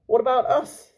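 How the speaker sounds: high, loud indignant waiter; complaining waiter. Acted. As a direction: protest like a hungry waiter.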